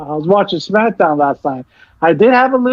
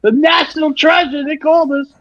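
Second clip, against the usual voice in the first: excited fan; 8 semitones higher than usual. Retold or imitated. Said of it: "I was like".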